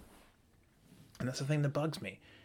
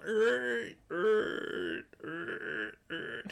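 high strangled choking er sounds; hammed-up strangled grunts; mock strangled gurgle; 10 semitones higher, much slower than usual. Mock hanging, strangled noises.

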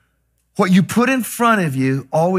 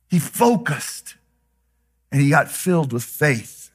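ordinary talk, nothing marked

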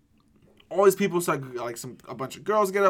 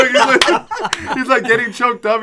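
loud laughing narration, pitched up; laughing while telling; 10 semitones higher, 13 dB louder, slower than usual. Real, not acted. Laughing hard while telling friends about drunk Matthew choking up. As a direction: crack up as you tell it.

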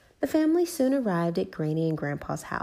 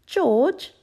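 high, loud little call of the name; Peppa copying Mummy Pig; 11 semitones higher, 7 dB louder than usual. A narrator's reading. Peppa to little brother George, bossy like Mummy. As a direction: call him to attention like a mini mum. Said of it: "just like Mummy Pig".

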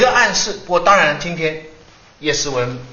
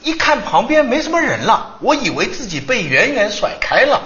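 lively retelling; 6 semitones higher than usual. Retold or imitated. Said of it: "他说".

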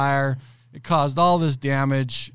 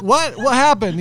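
high, loud alarmed question; 11 semitones higher, 8 dB louder than usual. Retold or imitated. Boss asking employee, high alarmed cry. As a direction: demand answers in shock. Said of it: "And I'm like".

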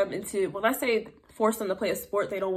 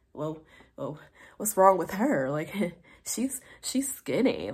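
gossiping whisper; 4 semitones lower, 3 dB quieter than usual. Retold or imitated. Aunts gossiping about skinny child.

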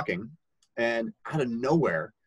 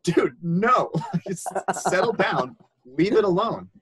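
loud, raised mock telling-off; retold exasperated rebuke; 8 semitones higher, 5 dB louder, slower than usual. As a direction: imitate a counselor shutting down a troublemaker.

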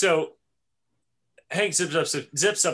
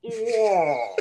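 high, loud strangled wail; hammed-up animal death cry; dying giraffe cry; 6 semitones higher, 5 dB louder than usual. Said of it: "going for the dying giraffe".

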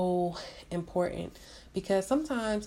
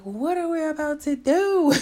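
high, loud, alarmed cry of disbelief; panicked outburst; 8 semitones higher, 6 dB louder than usual. Retold or imitated. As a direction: blurt it out in a panic. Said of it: "And I was so emotional".

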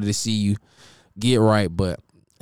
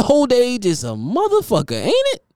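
higher, with a big rising squeak on ain't it; emphatic repeat; 11 semitones higher than usual. Shown as a demonstration. Teasing A-Rod about his age, ain't it squeaked up high. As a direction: lean in and shoot ain't it way up. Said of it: "repeated line".

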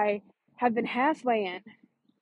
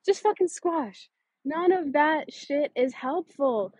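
slow, higher, each word pressed down; emphatic dismissal; 4 semitones higher, slower than usual. Real, not acted. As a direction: squash those doubts, slowly and firmly.

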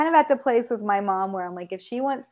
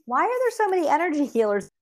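mild, higher-pitched retold bafflement; bewildered; 7 semitones higher than usual. Newcomer wondering at all the healers here, mildly bewildered. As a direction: lightly act out your own puzzlement.

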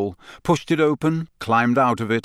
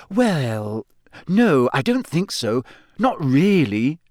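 hesitant, slower on the last words; awkward; 6 semitones higher, slower than usual. Acted. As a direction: evasive and unsure. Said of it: "said Hermione awkwardly".

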